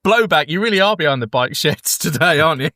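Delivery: ordinary talk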